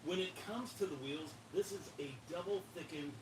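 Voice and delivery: upbeat product-review read; presenter voice. Shown as a demonstration. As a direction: pitch it like a TV presenter.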